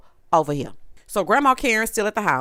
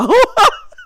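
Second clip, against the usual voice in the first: high, loud cackle of disbelief; loud laugh; 9 dB louder than usual. Real, not acted. Host watching the gelled sides, loud cackle. Host cracks up over hair gel.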